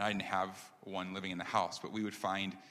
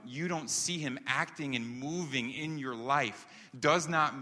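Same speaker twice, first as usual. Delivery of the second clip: higher, louder, driving sermon delivery; emphatic preaching; 5 semitones higher, 3 dB louder than usual. Real, not acted. Pastor telling the congregation God is at work, emphatic preaching. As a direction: press the point home to the congregation.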